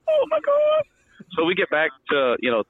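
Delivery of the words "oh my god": very high squealed "Oh my God"; mock squeal. Recruit about to lose it, mock squeal. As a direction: squeal in pure panic. Desperate need for toilet.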